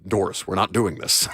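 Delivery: put-on a light, thick Eastern European accent; low deadpan with an Eastern European accent. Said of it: "in that very thick, uh, Eastern European accent".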